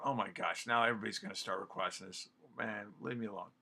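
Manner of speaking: low, quick, very soft grumble; exasperated controller. Acted. Controller swamped by speed requests, quietly exasperated. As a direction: complain under your breath, wanting to be left alone.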